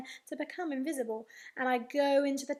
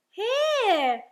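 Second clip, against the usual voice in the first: loud, high, sing-out reply; calling out; 6 semitones higher, 11 dB louder than usual. Acted. Invisible boy answering his mummy, calling out. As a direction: call out high so you are found.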